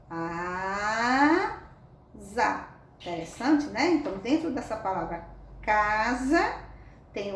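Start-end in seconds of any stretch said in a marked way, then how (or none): 0.1-1.6 "asa" said slowly and drawn out; sounding out. Shown as a demonstration. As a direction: stretch "asa" for the kids. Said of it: "Com a palavra". ("asa" bem alongado)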